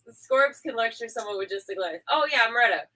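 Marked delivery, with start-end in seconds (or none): none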